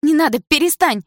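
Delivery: высокий, громкий женский крик; кричать высоко, умоляя прекратить. A narrator's reading. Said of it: "закричала Дана". (отчаянный крик)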